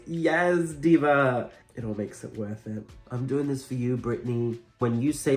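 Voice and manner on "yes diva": campy drag queen. Retold or imitated.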